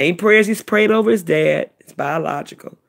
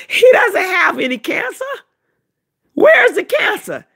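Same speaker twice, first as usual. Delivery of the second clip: much higher, louder, near-crying exclamation; indignant outburst; 14 semitones higher, 4 dB louder than usual. Retold or imitated. Specialist scoffing at the false cancer report, high loud outcry. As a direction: cry out in disbelief at the diagnosis.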